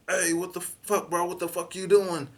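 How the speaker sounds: high, slow angry demand; drawn out angry challenge; angry stranger. Retold or imitated. Other man shouting.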